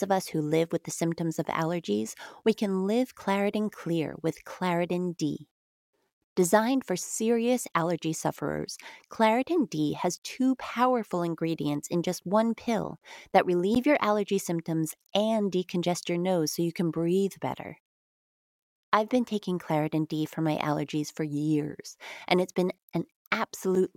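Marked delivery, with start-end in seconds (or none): none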